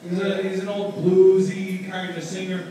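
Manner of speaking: ordinary talk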